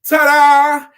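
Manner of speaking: high, very loud showman ta-da; ringmaster ta-da. Retold or imitated. Preacher acting out the acrobats for the congregation, big showy ta-da. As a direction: belt it out like a circus performer. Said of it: "and he's like".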